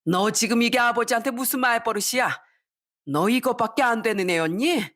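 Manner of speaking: mother's high-pitched reproach; angry scolding. Acted. Mother rebukes her son for rudeness to father, angry scolding. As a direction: demand an answer from your child. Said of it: "너무 화가 나서".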